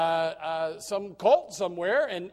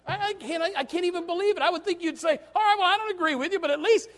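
higher, louder, fast pleading; rising full-force pulpit appeal; impassioned preaching; 11 semitones higher, 11 dB louder than usual. Real, not acted. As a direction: drive the point home to the pews.

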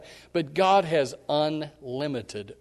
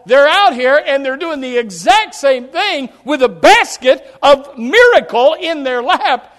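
louder, urgent sermon delivery; full-force preaching; 11 semitones higher, 15 dB louder than usual. Acted. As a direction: drive it home to the congregation.

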